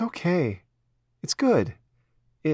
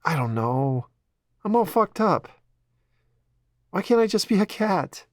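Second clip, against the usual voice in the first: put-on higher, teary girlish voice, breaking near the end; Ella through tears; 7 semitones higher than usual. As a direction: let the tears spill as she talks.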